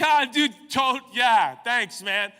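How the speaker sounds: loud, high fake cheer; forced laugh-along. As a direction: shout it out like you're in on the joke.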